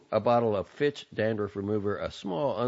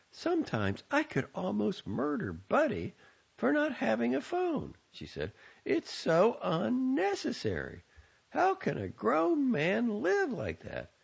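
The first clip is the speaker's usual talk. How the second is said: mother fretting about her son without a phone; 13 semitones higher than usual. A narrator's reading. Mother complains about Buddy.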